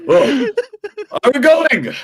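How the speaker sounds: put-on high, laughing drunken slur; a giggly drunk rousing himself; drunk wrestler. Drunk champion getting up to go fight, high and giggly. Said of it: "he's like".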